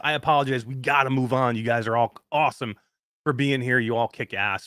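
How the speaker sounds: ordinary talk, nothing marked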